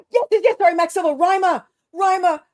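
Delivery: thrilled realization shouted at the screen; excited outburst. Real, not acted. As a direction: blurt out the name like you just remembered. Just spotted Rima in comments.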